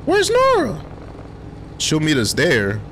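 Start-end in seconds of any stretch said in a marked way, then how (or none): 0.1-0.8 high friend voice. Acted. Voicing friend character.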